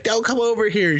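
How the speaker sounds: high put-on warning voice; mock warning. Boyfriend warning girlfriend off, high mock voice. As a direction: squeak a warning to stay back.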